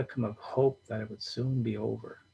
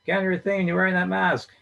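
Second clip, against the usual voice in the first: louder, higher mock complaint; teasing loud jab; 8 semitones higher, 7 dB louder than usual. A narrator's reading. Henry teases John over his mask, loud and mocking. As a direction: razz him about the mask.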